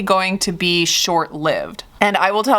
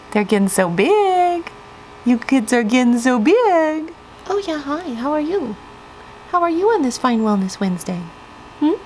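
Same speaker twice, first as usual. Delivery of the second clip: cooing pet talk; 8 semitones higher than usual. Real, not acted. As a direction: coo at the kittens like babies. Cooing at the kittens Cedar and Maple.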